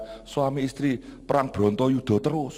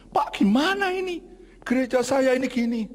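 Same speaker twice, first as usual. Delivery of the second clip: high-pitched, agitated complainer; complaining churchgoer; 11 semitones higher than usual. Retold or imitated. Congregant complaining to the pastor about his church, high-pitched protest. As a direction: raise the pitch in an aggrieved appeal. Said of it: "Komplainnya itu sharing".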